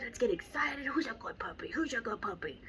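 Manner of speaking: a trainer whispering happy praise to a puppy. Shown as a demonstration. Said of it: "these happy sounds like".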